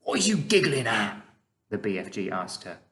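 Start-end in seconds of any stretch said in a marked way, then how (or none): 0.0-1.2 mildly put-out giant; a touch of irritation; slightly nettled. A narrator's reading. BFG asking giggling Sophie, slightly nettled. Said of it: "slightly nettled".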